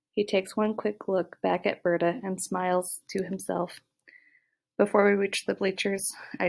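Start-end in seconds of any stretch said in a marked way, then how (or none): none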